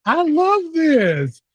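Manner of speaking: high, slow, delighted squeal on love; gushing delight. Real, not acted. Guest gushing to host about the named school, high and delighted. Gushing at host's open credentials.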